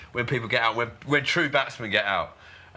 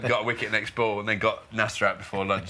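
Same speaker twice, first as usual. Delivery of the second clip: ordinary talk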